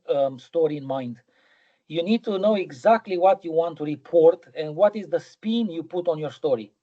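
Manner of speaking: usual speaking voice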